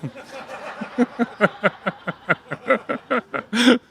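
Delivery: long high laughter at own joke; real laughing. Real, not acted. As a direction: laugh hard at the pun.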